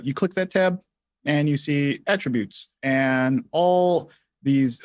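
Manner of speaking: ordinary delivery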